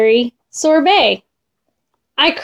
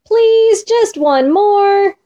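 building to a high, drawn-out plea; child's wail for a treat, no real shout; mild storybook yell; 7 semitones higher, slower than usual. A narrator's reading. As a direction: start near normal, then stretch the begging higher. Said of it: "I yelled on the way".

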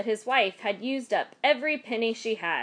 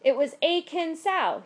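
ordinary delivery, nothing marked

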